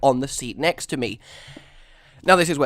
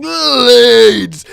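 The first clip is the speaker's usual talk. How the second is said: very loud, high drawn-out yell; screaming; 9 semitones higher, 14 dB louder than usual. Retold or imitated. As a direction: scream it at someone to stop them.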